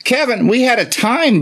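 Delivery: high, eager gushing at the caller; gusto. Acted. Southern belle teasing a caller about last night, high playful gusto. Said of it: "say this every time with gusto".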